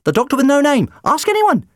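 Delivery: very high, loud pleading; high shout. Acted. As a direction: shout it up desperately. Doctor held overhead, shouting.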